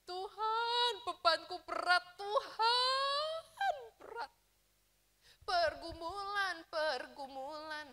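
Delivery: imitating a crying plea, pitch rising high; mock tearful prayer. Believer praying to God about heavy burdens, mock crying. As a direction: whimper the complaint to God, half-hearted sob. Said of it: "Nangis, nangis".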